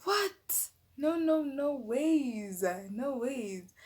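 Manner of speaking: high, slow no no no; slow emphatic refusal; flat refusal in shock. Retold or imitated. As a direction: refuse in disbelief. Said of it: "I'm like".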